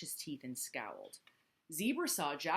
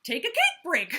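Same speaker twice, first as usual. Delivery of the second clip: high, loud laughing line; 12 semitones higher, 11 dB louder than usual. Acted. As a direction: laugh through the joke. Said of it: "laughed Kangaroo".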